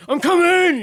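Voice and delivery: loud, high yell of "I'm coming!"; shouting. Retold or imitated. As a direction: yell it out at full volume. Calling out mid-obstacle.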